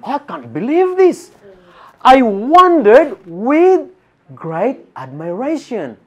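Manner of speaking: high, loud and slow, stunned; amazed disbelief. Retold or imitated. Preacher acting his shock at a woman on the street. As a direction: gasp it out like you can't believe your eyes. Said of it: "what do you think my reaction".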